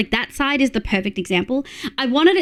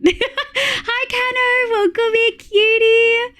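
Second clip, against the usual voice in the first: very high, squeaky-sweet hello; high-pitched cutesy welcome; cutesy greeting; 12 semitones higher, much slower than usual. Real, not acted.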